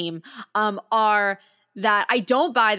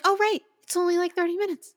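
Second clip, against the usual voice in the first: pitched way up, a sudden playful "oh, right!"; mock realization; 11 semitones higher, faster than usual. Telling her co-host how short the episode turned out, high mock surprise. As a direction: act out catching on with a high, light gasp of surprise. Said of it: "I'm like".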